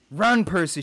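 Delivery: urgent cry. Acted. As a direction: cry out a desperate warning. Mother urges son to run.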